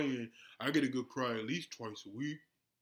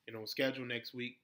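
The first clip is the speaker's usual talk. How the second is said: usual speaking voice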